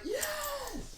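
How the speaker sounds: very high, hammed-up cheer; mock thrilled fan. Fan hearing the movie title, mock-ecstatic high cheer. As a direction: squeal it like an overexcited fan.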